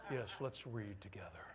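low, soft and gentle, laughing; soothing grandfather voice. Retold or imitated. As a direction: lull a sleepy grandchild. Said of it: "I pull out my soothing grandfather voice".

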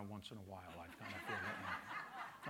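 quiet real laugh teasing Donna. Real, not acted. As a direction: chuckle through a teasing confession. Joking confession to Donna.

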